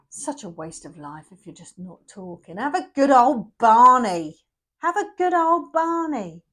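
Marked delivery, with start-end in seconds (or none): 2.6-4.4 louder, slow, egging them on to a row; a cheeky shout of encouragement; rally the viewer into a good old Barney; rousing call to argue. Acted. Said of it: "urging the couple to argue". 4.8-6.4 higher, slow, punchier take on it; a higher, more insistent good old Barney; repeated louder rally. Acted. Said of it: "repeating it, higher".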